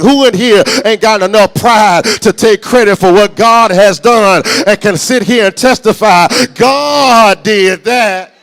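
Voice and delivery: booming preacher, drawing out the key words; full-force preaching. Real, not acted. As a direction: preach it out to rouse the whole congregation.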